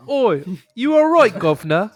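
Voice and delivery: over-the-top British bloke; English accent. Retold or imitated. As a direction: ham up a London geezer greeting.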